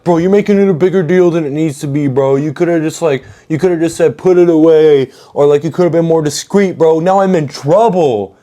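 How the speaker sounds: high, fast whiny excuses; defensive Melvin. Retold or imitated. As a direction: rattle off excuses like a sulky teen. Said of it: "And he's like".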